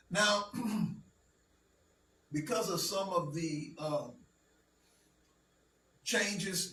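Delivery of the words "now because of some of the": normal talking voice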